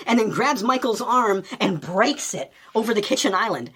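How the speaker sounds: ordinary talk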